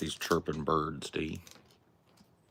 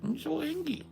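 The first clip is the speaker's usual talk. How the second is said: very high pet-talk voice; talking to the dog; 8 semitones higher, 4 dB louder than usual. Acted. Settling the dog onto its chair, very high doting pet voice. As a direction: coo at the dog way up high, sing-song.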